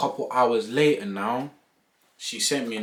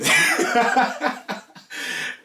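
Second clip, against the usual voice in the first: high laugh; 14 semitones higher, 4 dB louder than usual. Real, not acted. Laughing at the reaction.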